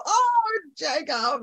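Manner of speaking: high swooping oh, then the name; delighted recognition. Pretend. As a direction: greet a famous face with delight. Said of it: "you go".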